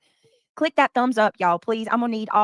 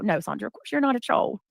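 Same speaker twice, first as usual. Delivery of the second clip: whisper. Real, not acted. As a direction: whisper it reassuringly. Reassuring a viewer in chat.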